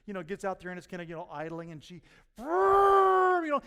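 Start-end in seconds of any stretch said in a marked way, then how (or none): 2.4-3.6 sudden high, loud, slow exasperated cry; a loud frustrated wail; frustrated shout. Retold or imitated. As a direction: burst out in frustration. Frustrated woman in boat story.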